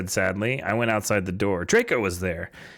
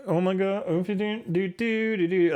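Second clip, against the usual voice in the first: mocking imitation; 8 semitones higher than usual. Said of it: "where he's like".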